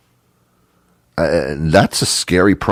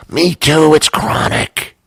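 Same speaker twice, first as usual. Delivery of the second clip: high squeal straining into a rasp; hammed-up ecstatic outburst, voice giving way; mock overjoyed; 9 semitones higher, slower than usual. Date finds a fellow sufferer, mock overjoyed squeal.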